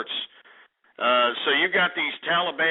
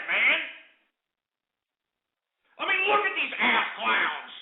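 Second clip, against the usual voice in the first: exasperated rant; 12 semitones higher than usual. Real, not acted. Host ranting at prank callers.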